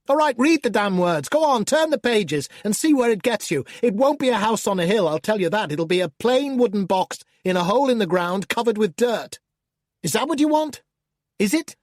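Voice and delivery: an angry demon losing his temper, loud and high; furious outburst. Acted. Demon shouting at the reader who won't stop reading, furious.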